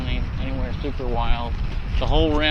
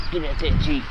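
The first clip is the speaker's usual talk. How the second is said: mock road rage. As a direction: bark it at the Jeep driver. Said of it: "Road rage on a scooter".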